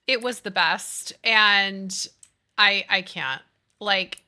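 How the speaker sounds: normal talking voice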